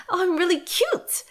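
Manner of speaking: high, sugary cutesy voice; mock cute voice. Mocking a cutesy speaker, high sugary voice. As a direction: imitate someone acting cute. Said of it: "if you're like".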